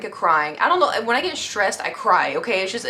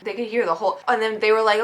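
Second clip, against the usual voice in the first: ordinary delivery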